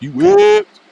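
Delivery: high, loud jeering call; mock taunt. Buns calling out his whipped friend, mock taunt. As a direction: shout it as a high teasing jeer. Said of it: "Buns is calling him".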